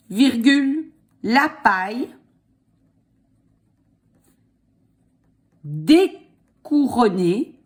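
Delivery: dictating. Shown as a demonstration. Dictating slowly.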